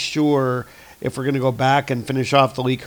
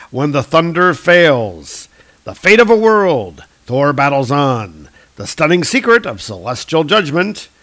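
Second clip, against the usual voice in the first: louder, slow trailer-voice reading; dramatic blurb read; 5 dB louder, slower than usual. Acted. Host reading the comic's cover blurbs aloud, as a movie trailer announcer. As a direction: sell each cover line like a movie trailer.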